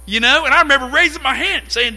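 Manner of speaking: normal talking voice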